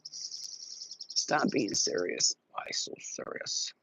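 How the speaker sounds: eerie slow whisper; whispered spirit voice. Acted. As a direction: breathe it like a spirit.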